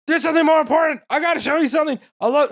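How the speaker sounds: high, wobbling mock confession; flustered Okran. Boy with something important to show her, high flustered voice. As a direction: imitate an anxious boy working up to a confession.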